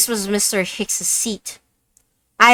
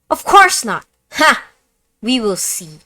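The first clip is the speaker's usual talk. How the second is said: loud, higher Russian accent retake; 5 semitones higher, 8 dB louder than usual. Shown as a demonstration. Suspect denies it again, loud in a Russian accent. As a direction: bark it out in a hammed-up Russian accent. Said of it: "let's just stick with one accent".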